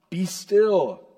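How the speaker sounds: loud, high, emphatic order; commanding. Acted. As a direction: call out firmly to halt them. God commanding Israel.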